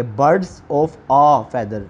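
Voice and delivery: "a" said clearly and held before "feather"; slow "Birds of a feather" with "a" set apart; teacher reading the answer slowly, "a" clear; stress on the article. Shown as a demonstration. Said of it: "तो हम आ लगाएंगे".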